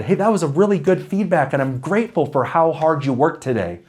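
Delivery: high, lilting, friendly praise; upbeat grateful tone. Shown as a demonstration. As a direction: demonstrate a non-critical, appreciative tone. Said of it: "I could sound like this instead".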